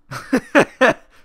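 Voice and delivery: high, loud burst of laughter. Real, not acted. Player laughing at the comeback to Jenny, loud laugh. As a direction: laugh out loud at your own joke.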